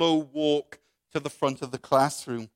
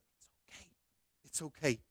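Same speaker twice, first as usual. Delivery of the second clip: barely audible, soft encouragement; hushed little go-ahead; whispered reassurance; 14 dB quieter than usual. Acted. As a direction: mouth it secretly, then murmur it. Jesus secretly reassures the student.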